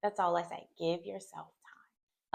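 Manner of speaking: usual speaking voice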